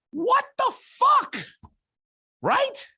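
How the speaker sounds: very high, loud, drawn-out disbelief; exasperated outburst. Real, not acted. Co-host venting to partner about network hiring, exasperated high yell. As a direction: shriek it slowly in sheer exasperation.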